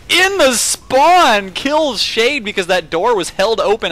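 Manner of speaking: drawn-out high excited call; excited commentary. Real, not acted. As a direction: stretch it out in excitement. Sudden kill in commentary.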